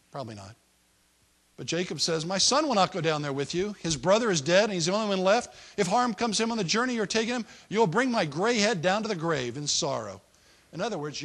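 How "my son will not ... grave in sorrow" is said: louder, pleading father's protest; grieving refusal. Retold or imitated. Jacob refusing his sons, a louder grieving protest. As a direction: refuse them, your voice full of grief.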